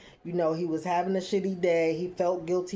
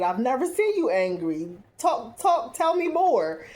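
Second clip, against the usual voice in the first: higher, eager tell me more; fascinated; 6 semitones higher than usual. Retold or imitated. A wife eager to hear about his anger, fascinated. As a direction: lean in, thrilled and curious. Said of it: "she's a little bit fascinated".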